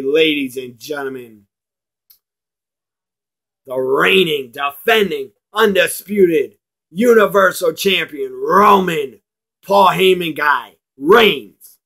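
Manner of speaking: announcer voice swelling into the champion's name; Paul Heyman announcement. Retold or imitated. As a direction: hype the champion like a manager at ringside, climbing. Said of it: "have Paul Heyman come out and endorse Roman and say".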